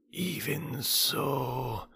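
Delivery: slow, soft unvoiced hissing; low snake voices. A narrator's reading. Cobras whispering slowly to Mowgli. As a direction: hush it like cobras in the dark. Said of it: "said half a dozen low voices".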